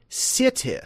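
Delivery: high "city" with two short I's, sih-tih; K K pronunciation. Shown as a demonstration. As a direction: clip both vowels of "city" to short I, crisp T. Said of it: "K K says to pronounce this word".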